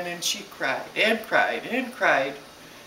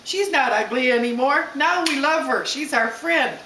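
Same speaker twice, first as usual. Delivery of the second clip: put-on high, louder children's chorus, taunt turning friendly; schoolkids; 8 semitones higher, 4 dB louder than usual. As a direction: play excited kids changing their minds, pitched up. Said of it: "and they said".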